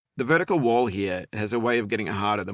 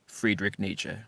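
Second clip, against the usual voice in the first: normal talking voice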